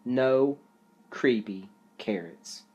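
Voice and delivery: ordinary delivery, nothing marked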